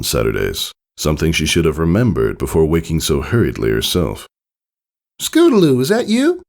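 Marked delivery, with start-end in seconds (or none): 5.2-6.4 high, loud filly voice calling out; high filly calling out to her friend; young filly calling. A narrator's reading. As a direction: call from behind in a high little-girl voice. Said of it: "came Apple Bloom's voice from behind".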